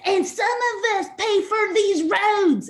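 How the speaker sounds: high, loud, slow furious shout at a cyclist; angry driver yelling from his car window. Retold or imitated. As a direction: bellow it out like an enraged driver. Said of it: "he's screaming at me".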